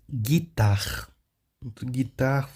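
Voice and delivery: "Guitar" as gui-tar with silent U; French pronunciation demo. Shown as a demonstration. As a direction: model "Guitar" twice, stressed on the last syllable. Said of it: "não vai pronunciar a letra U".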